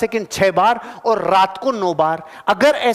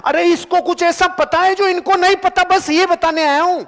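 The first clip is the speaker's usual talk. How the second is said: raised, much higher-pitched emphatic burst; impassioned insistence; 10 semitones higher, 3 dB louder than usual. Real, not acted. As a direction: drive the key point home, voice pitched high. Driving home his key point. (ऊँची आवाज़ में जोशीला आग्रह)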